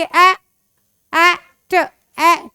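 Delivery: "ए" and "ट" as separate letter sounds; wrong reading. Shown as a demonstration. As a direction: misread it with "ए" instead of long ee. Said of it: "हम इसको ऐसे नहीं पढ़ेंगे". (गलत तरीका: "ए", "ट" अलग-अलग)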